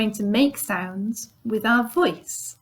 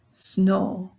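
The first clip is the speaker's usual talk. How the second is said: usual speaking voice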